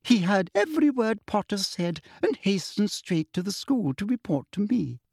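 savouring her trump card; smug. A narrator's reading. Umbridge answering McGonagall, smug. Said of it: "said Umbridge smugly".

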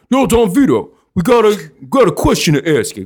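a hint of Philly Italian swagger; lightly voiced South Philly guys. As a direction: call him out like a bar regular. Acting Italian guys in bar story.

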